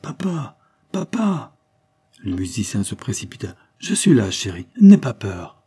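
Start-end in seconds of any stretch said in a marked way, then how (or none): 0.0-1.6 high-pitched woman's cry for her father; heart-rending call. A narrator's reading. Daughter calls her father from behind the partition, distressed. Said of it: "un appel déchirant derrière la cloison, une voix de femme". 3.8-5.6 loud call to soothe, slowing at the end; urgent reassurance. Acted. Father rushes to his frightened daughter, urgently reassuring.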